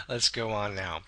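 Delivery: usual speaking voice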